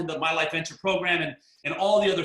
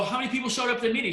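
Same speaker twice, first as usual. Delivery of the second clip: eager question; 7 semitones higher, much faster than usual. Retold or imitated. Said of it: "And I go".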